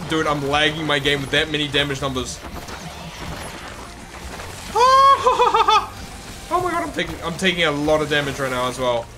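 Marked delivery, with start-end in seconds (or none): 2.4-6.5 high-pitched laugh; high giggle. Real, not acted. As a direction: burst into a giggle. Laughing at damage numbers.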